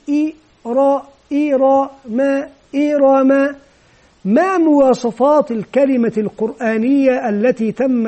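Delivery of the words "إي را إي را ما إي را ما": "إي را ما" built up syllable by syllable, each held evenly; spelling out syllables. Shown as a demonstration. Teacher starts spelling for the class, "إي را ما" in measured, chanted syllables. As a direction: sound out "إي", "را", "ما", then join them as "إي را ما". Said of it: "ثم يبدأ في التهجي".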